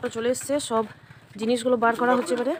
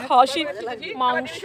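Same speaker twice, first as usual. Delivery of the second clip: usual speaking voice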